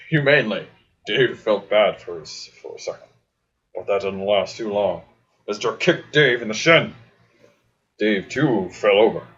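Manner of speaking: ordinary delivery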